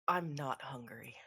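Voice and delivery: low, slow, soft deadpan; deadpan refusal. Acted. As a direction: refuse slowly in a dead, low voice. Deadpan character coldly refuses the food.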